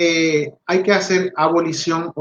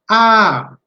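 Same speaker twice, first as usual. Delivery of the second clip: Ah called out loud and higher, touching the mouth; modeling shout; 6 semitones higher, 6 dB louder than usual. Shown as a demonstration. Therapist models the first sound to the child, loud. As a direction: shout a long clear Ah for the child to copy. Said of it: "Vuelvo a repetir la acción".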